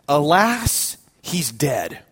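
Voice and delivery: slow, solemn bishop, sinking on the last words; mock-solemn pronouncement, falling away; grave announcement. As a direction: break bad news gravely, dropping low. Bishop breaks grave news.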